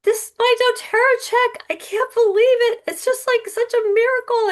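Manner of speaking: high, teary, choked-up gush; crying on the phone. Retold or imitated. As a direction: sob it out in disbelief and joy. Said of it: "I called Peggy crying".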